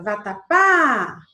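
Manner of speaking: "vatapá" with a loud, long-held last syllable "pá"; stress on final "pá". Shown as a demonstration. Teacher asking where the voice lingers, stretched final "pá". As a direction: hold and punch the "pá" of "vatapá". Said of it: "onde foi que eu passei mais tempo?".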